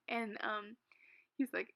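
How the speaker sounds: usual speaking voice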